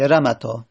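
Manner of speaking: "رمتا" said ra-ma-tā, middle a short; alef dropped. Shown as a demonstration. Teacher repeating the shortened form, "رمتا" with short middle vowel. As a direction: keep "رمتا" short until the final ā.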